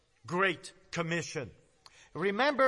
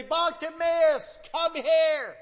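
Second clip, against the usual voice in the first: higher, louder, slow calling out; mock yell; 8 semitones higher, 5 dB louder, slower than usual. Jesus yelling back at the blind man, calling. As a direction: call across the crowd. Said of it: "wanted to yell back".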